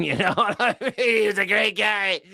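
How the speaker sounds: hammed-up Trump bragging; Trump impression. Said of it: "That is what he said".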